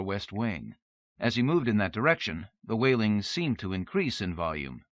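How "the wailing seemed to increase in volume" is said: plain reading voice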